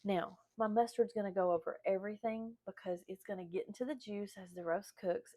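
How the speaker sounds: ordinary delivery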